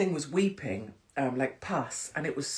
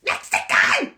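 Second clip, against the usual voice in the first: piercing mock northern English shout; hammed-up accent, very high and loud; James English; 10 dB louder, slower than usual. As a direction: yell it in a badly faked northern English accent. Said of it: "however James English speaks".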